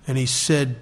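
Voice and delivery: normal talking voice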